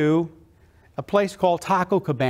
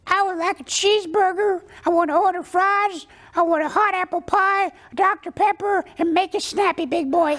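very high duck ordering food; bossy little duck voice, pitched way up; cartoon duck; 12 semitones higher than usual. Boy prank-orders at the drive-through, high cartoon duck voice. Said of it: "I think there's a duck in the red car".

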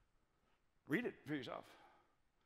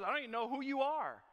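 higher-pitched, mock-bewildered protest; baffled protest; 8 semitones higher, 3 dB louder, faster than usual. Saul replying to an unknown voice, baffled protest. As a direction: protest that this is a total stranger.

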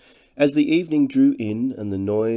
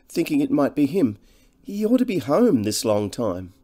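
higher-pitched, deferential countrywoman; humbly fretful; 6 semitones higher, 5 dB louder than usual. A narrator's reading. Cottage woman worrying over Harry being out late, humbly fretful. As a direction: fret humbly in a raised woman's voice. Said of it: "She spoke in a humbly fretful voice".

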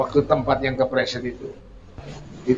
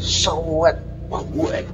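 slip and thud noise; 3 semitones higher, 3 dB quieter, much slower than usual. Retold or imitated. The preacher imitates the young man slipping in the mud, a mock skid noise. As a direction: make the noise of a man skidding and falling. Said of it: "muni suarane sewet ngono".

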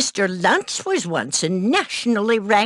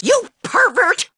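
outraged cry; 12 semitones higher than usual. Acted. Outraged at intruder.

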